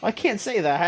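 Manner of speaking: giggling through the quote; laughing quote. Retold or imitated. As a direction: say it while laughing. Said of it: "they were just like".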